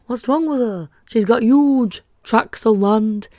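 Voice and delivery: comic Yorkshire accent impression; hammed-up Yorkshire sketch character; Michael Palin's Yorkshireman. Shown as a demonstration. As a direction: put on a Monty Python Yorkshire voice. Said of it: "it's the Michael Palin, like".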